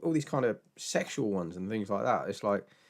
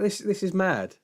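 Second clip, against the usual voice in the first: incredulous; 11 semitones higher, 4 dB louder than usual. Retold or imitated. Reacting to whisper method.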